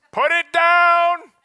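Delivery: high, loud and slow command. Acted. Inner voice ordering a snacker to stop, loud and slow. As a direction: order yourself to drop the cookie.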